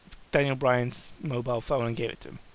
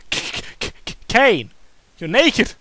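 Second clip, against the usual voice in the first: stammering, then a higher, louder shocked cry; surprised exclamation; 6 semitones higher, 8 dB louder than usual. Acted. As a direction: stutter and blurt it out in shock. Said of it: "He exclaimed in surprise".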